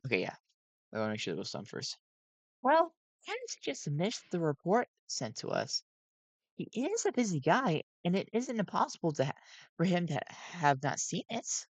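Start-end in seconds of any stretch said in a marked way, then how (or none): 2.6-11.7 high, nervous animatronic voice; jittery gentle robot voice; Sun voice. A narrator's reading.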